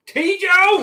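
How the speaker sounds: calling out. Real, not acted. Calling out the name.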